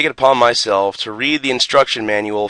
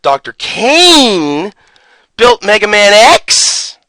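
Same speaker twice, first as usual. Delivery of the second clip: slow, then "built" leaps higher and louder into a rising question; incredulous; 7 semitones higher, 6 dB louder, much slower than usual. Real, not acted. Telling viewers what his friend believed, incredulous. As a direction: ask it like it's the dumbest thing ever heard.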